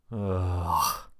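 a soft, tired "ugh" of reluctance; weary groan. Shown as a demonstration. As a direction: sigh it out like you dread the chore. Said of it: "That can feel like".

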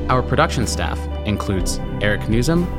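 ordinary talk

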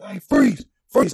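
sharp high shouted freeze; retold cop yell. Cop shouting at a man walking home, loud command. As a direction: bark it like a cop stopping you. Cop yelling at him in story.

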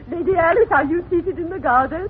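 usual speaking voice

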